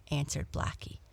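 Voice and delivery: ordinary delivery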